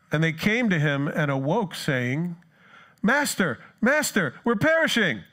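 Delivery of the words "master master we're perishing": high, loud desperate cry for help; frantic cry. Retold or imitated. Disciples waking Jesus in the storm, high loud panic. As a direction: cry out as if the boat is sinking.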